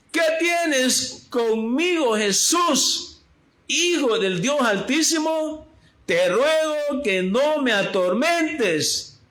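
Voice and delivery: high, slow, strained cry of a tormented demon; demon's cry. Acted. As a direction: voice the possessed man crying out to Jesus. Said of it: "eso no fue tan fuerte lo que yo hice ahorita".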